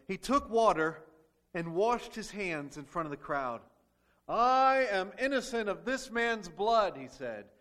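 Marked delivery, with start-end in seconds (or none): 4.3-6.9 slow, loud, solemn proclamation; Pilate declaring. Acted. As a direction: pronounce it before the crowd.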